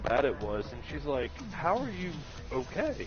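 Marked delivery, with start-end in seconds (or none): 1.5-2.9 higher, imitated astonishment; lightly acted incredulous question; disbelieving mom. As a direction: mimic a mother baffled by what she sees. Mom sees the bad wreck.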